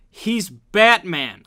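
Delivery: loud, slow, high announcement; excited exclamation. Real, not acted. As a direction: proclaim it triumphantly.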